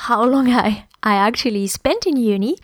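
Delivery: normal talking voice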